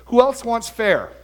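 high, slow mock dare; acted triumphant challenge, drawn out; professor's challenge. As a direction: throw it out to the whole class. Professor challenges the whole class.

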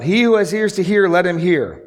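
high, loud proclamation of "He who has ears to hear"; called out. Acted. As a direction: call it out to the crowd. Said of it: "he called out".

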